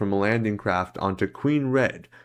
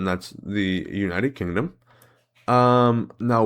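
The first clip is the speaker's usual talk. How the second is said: ordinary delivery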